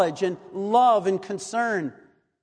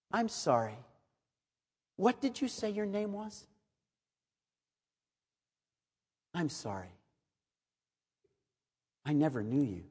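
hushed, grave reply to the pretender; cold dismissal; 4 semitones lower, 9 dB quieter than usual. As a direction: quietly turn them away as strangers. The Lord answering the false believer.

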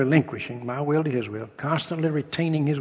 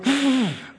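shocked gasp; 10 semitones higher than usual. Retold or imitated. Rich young ruler hears give money.

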